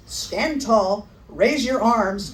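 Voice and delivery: plain reading voice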